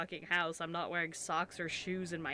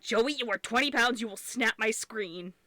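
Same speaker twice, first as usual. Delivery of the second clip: loud, higher exasperated telling-off; mock scolding; 4 semitones higher, 7 dB louder than usual. Owner telling off a heavy cat, mock scolding.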